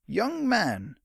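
high, emphatic interruption; exasperated storekeeper; stern shopkeeper. Acted. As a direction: cut the boy off sternly. Shopkeeper cuts the boy off.

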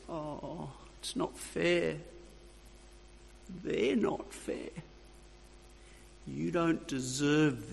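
lightly voiced tempter, soft and sly; a hint of a sly soothing devil. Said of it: "the little voice goes something like this".

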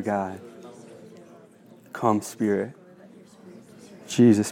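low, hushed prayer voice, lingering on each line; spoken prayer. Acted. Leads an opening prayer.

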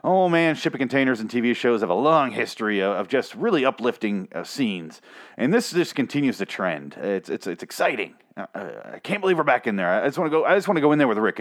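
ordinary delivery

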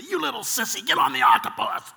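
harsh, loud rasping rant; mock-furious parent berating a kid; worst parent. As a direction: growl it out loudly at the child. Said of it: "unless you're the worst parent".

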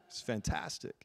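normal talking voice

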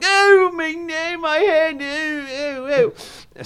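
high, slow drawn-out moans of pain; hammed-up pained moaning. Shown as a demonstration. Actor demonstrating the hit on the head, slow high moaning. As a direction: ham up the actor's groan after the cane hit. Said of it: "when they're going".

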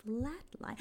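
breathy whispered word. Real, not acted. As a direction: whisper it like a secret. Whispered title.

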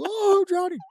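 high, loud mock cry of drowning; mock panic. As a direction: wail it like you're really going under. Pretends drowning after dumping water.

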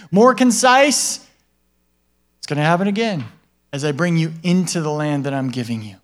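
usual speaking voice

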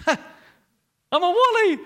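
high, loud, slow "I'm a Wally"; silly voice. Shown as a demonstration. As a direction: stretch out "I'm a Wally" like a clown. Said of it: "Yeah, I'm a Wally".

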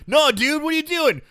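high, loud acted protest; mock alarm. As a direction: plead in comic panic. Acted student protesting to Wishmaster.

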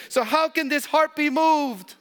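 ringing pulpit question; impassioned preaching. Acted. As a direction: lift the question up with full force. Preacher's rising climax.

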